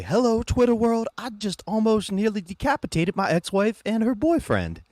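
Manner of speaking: high, loud put-on voice for a hammed-up O J; high hammed-up O J tweeting from the chase; mock O J voice. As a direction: pitch way up and announce it like a cheery influencer. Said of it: "where it's O J".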